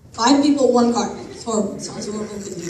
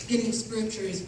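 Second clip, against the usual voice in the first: ordinary delivery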